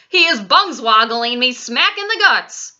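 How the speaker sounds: loud shouted complaint; indignant shout. A narrator's reading. Giant kicked awake in the belly, indignant shout. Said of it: "shouted the gizzard gulper".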